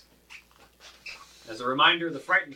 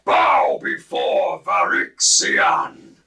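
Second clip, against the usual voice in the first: huge, slow, low dragon roar; 7 semitones lower, 13 dB louder, much slower than usual. Acted. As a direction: bellow it out as a monstrous dragon. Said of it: "and it roars".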